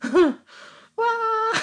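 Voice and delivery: high, loud "wah" breaking into laughter; real whoop bursting out with a laugh; ecstatic laughing whoop. Real, not acted. Overcome by the child's word, ecstatic laughing whoop.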